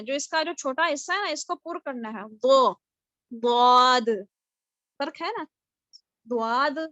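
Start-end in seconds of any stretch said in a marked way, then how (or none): 2.3-4.1 دواد said with a hard d, loud; wrong dwad demo. Shown as a demonstration. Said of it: "یہ تینوں طریقے بالکل غلط ہیں". 6.2-6.8 دواد with a plain d sound; the wrong دواد, said as a d; wrong dwad demo. Shown as a demonstration. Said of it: "یہ تینوں طریقے بالکل غلط ہیں".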